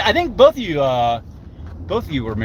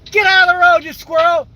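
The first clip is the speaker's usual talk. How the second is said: very high-pitched scolding holler; mock-parent yell, pitched way up; yelling at squirrels; 11 semitones higher than usual. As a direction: shoo a squirrel off the road like a naughty kid. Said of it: "I stop and yell at squirrels".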